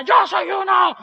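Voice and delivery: high, stretched mock yell; mock crowd yell. Crowd answering the slogan, imitated high yell. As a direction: answer like a fervent convert. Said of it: "Y la gente gritaba".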